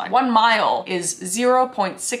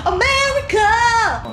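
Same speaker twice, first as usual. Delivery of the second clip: high, loud, long-drawn whoop; mock patriotic yell; 13 semitones higher, 5 dB louder, much slower than usual. Vlogger cheering at an American clip, mock patriotic yell. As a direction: bellow it like an over-the-top patriot.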